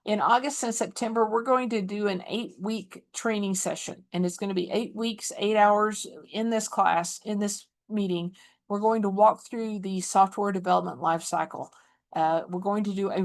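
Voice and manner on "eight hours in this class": ordinary delivery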